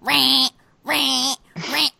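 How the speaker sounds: high, loud imitated phone alarm; piercing repeated ring noise. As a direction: mimic a blaring phone alert.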